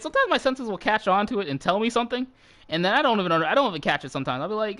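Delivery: usual speaking voice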